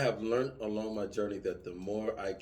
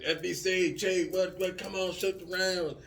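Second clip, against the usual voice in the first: higher, urgent call to fire; mock boss yelling; 9 semitones higher than usual. Boss yelling at fire direction to shoot, urgent higher call. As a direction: push like a boss demanding rounds now. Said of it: "he yelling".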